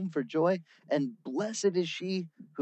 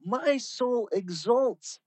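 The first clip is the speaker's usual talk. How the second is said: high, very slow joyful exclamation; Mary praising God, high and drawn out; crying out in joy; 9 semitones higher, much slower than usual. Acted. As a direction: stretch it out with rising joy. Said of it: "cries out".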